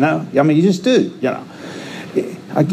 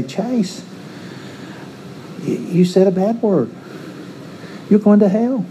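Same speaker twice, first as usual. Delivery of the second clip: a lightly voiced, higher stern God scolding; mock scolding God; 9 semitones higher, 3 dB quieter than usual. Preacher voicing a petty God scolding him, lightly mocking. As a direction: play a petty judge wagging a finger.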